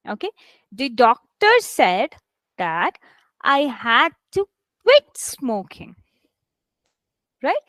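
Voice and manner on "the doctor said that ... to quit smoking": slow model sentence, "doctor" and "quit" stressed; teacher's slow read with "quit" picked out; stressed key words. Shown as a demonstration. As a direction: break it into chunks and lean on "doctor", "said" and "quit". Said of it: "where the stress should be".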